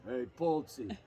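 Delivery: a low, quiet murmur as the manager; low Bochy voice. Retold or imitated. Bochy grabs the singer, says it low. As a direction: lean in low as the gruff skipper. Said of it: "Bochy grabs me and he goes".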